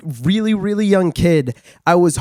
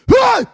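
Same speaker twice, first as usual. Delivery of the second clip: mock scare yell. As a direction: jump out at a baby with a yell. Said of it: "kept going".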